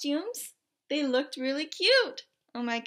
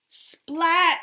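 loud high bang of a word; loud splat; 5 semitones higher, 11 dB louder than usual. A narrator's reading. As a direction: burst it out. Story climax sound word.

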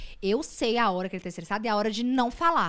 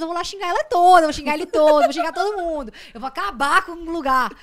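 loud, higher-pitched mock tirade; jealous rant; 5 semitones higher, 10 dB louder than usual. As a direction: threaten to tell everyone off, loudly. Imita o próprio ataque de ciúme. (ameaça ciumenta, alta e exagerada)

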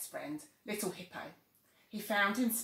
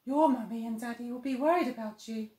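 put-on bush baby voice; 4 semitones higher, 6 dB louder than usual.

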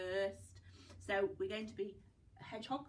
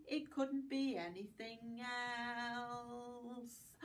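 slow; 2 semitones higher, 3 dB quieter, much slower than usual. Shown as a demonstration. Rhyme closing line.

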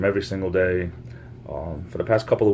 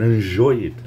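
put-on French accent; 3 semitones higher, 5 dB louder than usual. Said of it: "I should do a French accent more often".

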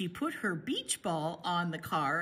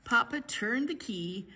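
ordinary delivery

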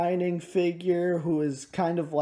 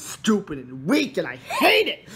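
punched, shouted words with "hate" highest; playful loud contempt; mock hateful outburst; 4 semitones higher, 5 dB louder than usual. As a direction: spit out a hammed-up tirade, peaking on "hate". Mock tirade about the spider.

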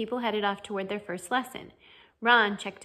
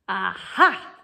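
loud, triumphant "aha"; hammed-up knightly cry; yelled; 7 dB louder than usual. A narrator's reading. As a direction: pounce on it like a challenge. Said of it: "he yelled".

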